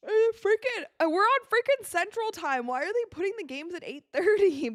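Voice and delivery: high, loud complaint about the schedule; fed up with the late start; annoyed rant. Real, not acted. Ranting about late game times.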